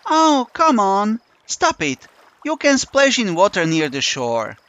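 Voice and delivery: high, slow pleading whine; coaxing. Acted. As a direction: wheedle him into the water. Yulia coaxing the narrator.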